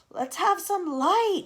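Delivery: high, loud, slow call into the dark; shout. Acted. Centipede shouting for light in the dark. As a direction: yell it across the dark room. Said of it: "shouted the centipede".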